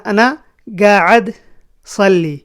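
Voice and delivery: قاعد said as gaad, with a hard g; g for qaf. Shown as a demonstration. Teacher repeats قاعد pronounced as gaad. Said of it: "قاف کو گاف بنا دیتے".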